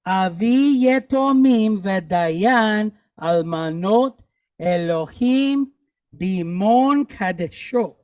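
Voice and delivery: slow, evenly drawn-out Hebrew reading; slow read-along. Shown as a demonstration. Teacher repeating the sentence with class, slow and deliberate. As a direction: stretch each word for learners to follow. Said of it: "Let's do it again".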